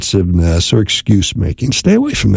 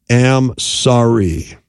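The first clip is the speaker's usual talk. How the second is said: slow, deliberate; much slower than usual. Shown as a demonstration. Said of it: "followed by".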